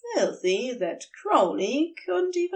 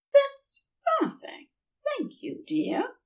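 grateful Aziraphale. A narrator's reading. Aziraphale thanks his husband for the tea, fondly and high. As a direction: thank him sweetly.

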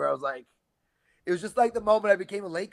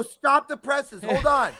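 mock alarm; 9 semitones higher, 3 dB louder, slower than usual. Mock alarm at old news.

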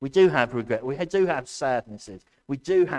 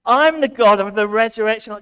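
loud, higher proclaimed cry; exultant shout; 6 semitones higher, 8 dB louder than usual. Acted. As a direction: shout it out in triumph. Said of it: "am I shouting a lot".

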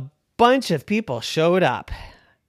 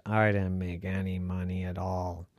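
ghost voice; 9 semitones lower, 11 dB quieter than usual. A narrator's reading. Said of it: "And the ghost said".

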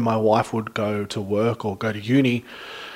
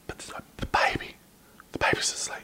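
whispered, no voice; 10 dB quieter than usual. Retold or imitated. Dad to cop at the checkpoint, urgent whisper. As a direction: whisper urgently so the baby sleeps. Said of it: "I was like".

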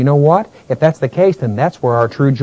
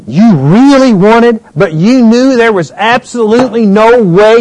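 loud, high fervent preaching; preaching at full force; 9 semitones higher, 7 dB louder than usual. Acted. Preacher pleading with the church, at full force. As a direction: press it on the congregation like a revival preacher.

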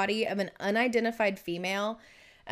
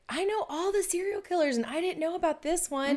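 shocked; 9 semitones higher than usual. Retold or imitated. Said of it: "I was like".

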